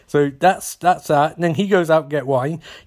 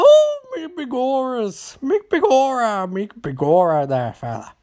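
put-on botched Irish accent; 9 semitones higher than usual. Irish hotel man exclaiming to guests, botched accent fading out. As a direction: squeal in bad stage Irish, then let it sag. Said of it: "I don't know what fucking accent".

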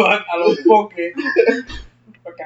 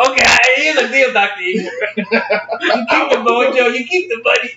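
Fat Bastard impression; 7 semitones higher than usual. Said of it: "Fat Bastard from Austin Powers".

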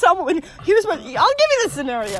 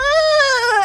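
high, loud mock groan of a tantrum; hammed-up fit; imitated whiny groan; 12 semitones higher, 6 dB louder than usual. Retelling Bailey's drive-thru tantrum, a loud whiny mock groan. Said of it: "he was going".

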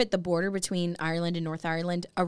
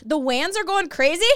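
put-on higher Northern Irish accent; 7 semitones higher, 4 dB louder than usual. Host quoting a Derry phrase, Northern Irish accent. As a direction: say wans the Derry way. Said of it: "could do some of the accents".

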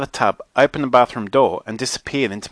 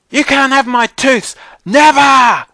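put-on high, loud little-girl shout; brave child's shout; 14 semitones higher, 8 dB louder, slower than usual. A narrator's reading. Brianna refuses to give up her tooths, shouting bravely. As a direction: shout back defiantly as a small child. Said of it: "Brianna shouted bravely".

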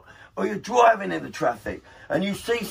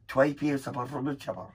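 put-on Scottish accent; 4 semitones lower, 5 dB quieter than usual. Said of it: "That's how she said it".

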